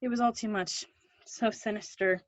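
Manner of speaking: ordinary talk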